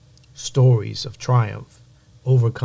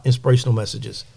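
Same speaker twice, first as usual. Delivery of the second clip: usual speaking voice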